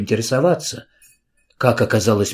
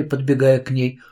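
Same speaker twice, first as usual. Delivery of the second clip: usual speaking voice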